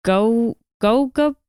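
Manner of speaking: ordinary delivery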